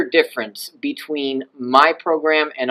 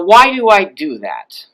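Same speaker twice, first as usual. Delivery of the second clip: normal talking voice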